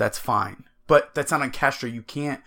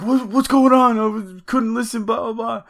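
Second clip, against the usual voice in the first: much higher, louder, worked-up friend asking what's going on; excited friend; 11 semitones higher, 4 dB louder than usual. Retold or imitated. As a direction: play the frantic buddy calling in mid-game. Friend calls asking what happened.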